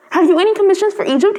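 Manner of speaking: a swallow excitedly calling out as he departs; eager cry. Acted. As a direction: call it out eagerly, ready to leave. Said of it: "he cried".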